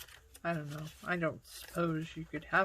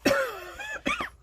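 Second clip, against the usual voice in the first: hard coughing fit; choking cough; 9 dB louder than usual. Real, not acted. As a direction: cough to clear the throat. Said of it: "Swallowed a bug".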